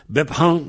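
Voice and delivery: "bep han" with H blown after P; H prononcé. Shown as a demonstration. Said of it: "celui qui peut prononcer le H". (« bep han » avec le H soufflé)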